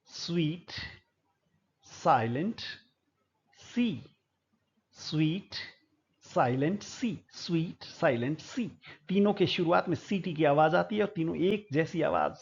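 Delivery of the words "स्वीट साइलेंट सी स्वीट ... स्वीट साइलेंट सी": spaced-out single words, gradually joining into a phrase; demonstrative repetition. Shown as a demonstration. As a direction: say it softly and deliberately so listeners catch the repeated sound. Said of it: "इसको मैं दो तीन बार बोल रहा हूं". (ध्यान से सुनाने के लिए ठहर-ठहर कर)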